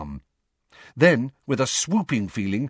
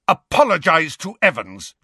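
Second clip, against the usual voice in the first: loud angry roar; 7 semitones higher, 5 dB louder than usual. A narrator's reading. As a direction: bellow the order at him. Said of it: "James roared at Snape".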